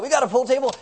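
mock astonished listener; surprised question. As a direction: imitate someone amazed to hear it. Voices surprised congregant's question.

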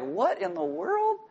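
high, soft, drawn-out disbelief; baffled onlooker. Acted. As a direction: act out a bewildered newcomer, slowly. Imitates a baffled newcomer walking in.

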